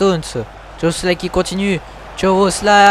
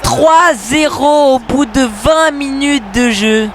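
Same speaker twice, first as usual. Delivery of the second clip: excited sports commentary; 9 semitones higher, 5 dB louder, slower than usual. Acted. Third goal celebrated.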